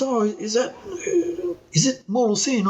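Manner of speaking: hesitant British caller, lightly imitated; Pommy accent. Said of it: "it's a Pommy accent".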